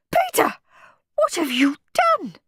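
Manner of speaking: high-pitched scolding cry from a horrified mum; shocked mum calling out her son; mother's shout. A narrator's reading. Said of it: "shouted Mum".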